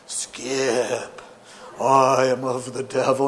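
drawn-out, put-on devilish caller; spooky voice. Phony caller confronting Skip on the phone, mock spooky. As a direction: stretch each word out like a phony ghoul. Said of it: "he would talk in this spooky voice".

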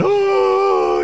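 hammed-up high wailing cry; a professional mourner, wailing for pay; mock wail. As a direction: fake a loud over-the-top mourner's wail. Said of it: "they would just, like, cry for you".

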